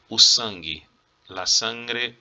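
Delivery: usual speaking voice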